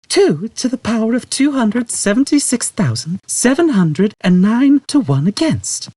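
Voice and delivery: Trillian. A narrator's reading. Trillian reciting figure.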